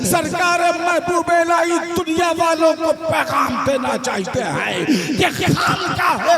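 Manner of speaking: loud, high preaching build over music; preaching at full force. Acted. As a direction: drive the refrain home to the crowd. (Josheela was, ऊँची आवाज़ में)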